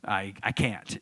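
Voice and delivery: small, low, soft stammer; nervous. Retold or imitated. Co-star at the premiere unable to talk, nervous murmur. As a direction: imitate someone too nervous to speak. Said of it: "so nervous".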